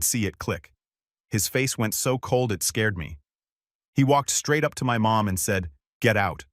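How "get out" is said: flat, dead delivery, no rise in the voice; level, understated command. A narrator's reading. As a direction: order someone out with no emotion. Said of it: "Just two words flat".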